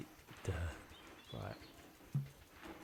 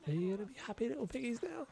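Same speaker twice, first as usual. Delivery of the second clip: much higher, quick cooing at the little piggies; baby talk to pigs; 12 semitones higher, faster than usual. Acted. Gamer cooing at the warthogs, high baby talk. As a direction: coo at them like pets.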